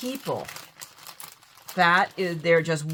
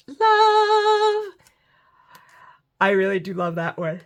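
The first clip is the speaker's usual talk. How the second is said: high, loud, drawn-out swoon; long high squeal of love; gushing delight; 9 semitones higher, 4 dB louder, slower than usual. Real, not acted. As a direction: sing it out in adoration. Gushing over a vintage car piece.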